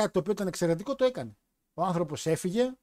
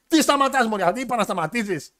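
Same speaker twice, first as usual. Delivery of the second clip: high, loud mock protest; exasperated shout; 5 semitones higher, 5 dB louder than usual. Husband objecting to wife stopping, shouted in mock outrage. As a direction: burst out indignantly, then ease off slightly.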